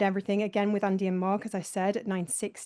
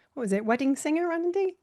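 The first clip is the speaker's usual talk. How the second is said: ordinary talk, nothing marked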